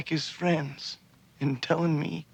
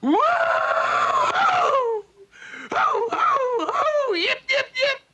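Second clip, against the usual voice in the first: very high whooping yip yip; loud, high imitated cowboy whoop; cowboy yell; 5 dB louder, much slower than usual. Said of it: "and he yells".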